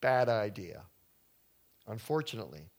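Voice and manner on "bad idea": backing down. Pretend. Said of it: "They're like".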